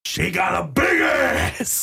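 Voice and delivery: slow, shouted Pacino growl; Al Pacino impression. Imitating Al Pacino for a friend, slow shout. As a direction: yell it slow like Pacino on a rant. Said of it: "my awesome Al Pacino".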